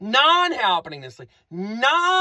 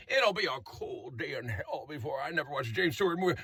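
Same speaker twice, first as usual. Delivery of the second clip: put-on much softer, low old-man grumble; James Stewart-like old man; 8 semitones lower, 12 dB quieter, faster than usual. Resident complaining about the noise, quiet James Stewart voice. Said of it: "Someone is going".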